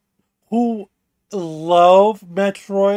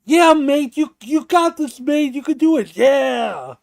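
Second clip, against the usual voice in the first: higher, hearty British cheer, shouted; English bloke; 7 semitones higher, 4 dB louder than usual. Retold or imitated. Mimicking a hyped English bloke cheering someone on, British accent. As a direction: cheer him on like a British mate. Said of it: "this English bloke".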